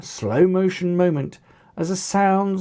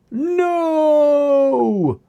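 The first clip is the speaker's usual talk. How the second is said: high, loud desperate cry of no; shout; 10 semitones higher, 5 dB louder than usual. A narrator's reading. Zack shouting to save his brother, loud desperate cry. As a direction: yell it as you lunge to help. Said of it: "shouted Zack".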